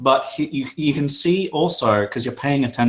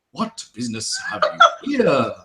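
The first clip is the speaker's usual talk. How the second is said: breaking into laughter mid-line; laughing in character; 10 semitones higher than usual. Acted. Mayor asks the party's business, laughing. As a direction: voice the mayor while cracking up. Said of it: "He goes".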